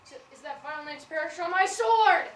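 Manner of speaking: loud, high threat of the sword; fierce shout. Acted. Lysander vowing to kill Demetrius with his sword, fierce shout. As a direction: rage it out at full voice.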